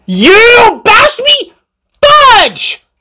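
high, drawn-out outraged cry; shrieked protest after being bumped; indignant yell. A narrator's reading. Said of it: "yelled Becky".

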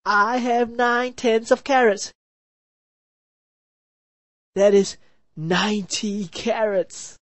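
slow, high weary voice; tired. A narrator's reading. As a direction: sigh it out tiredly. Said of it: "very tiredly said".

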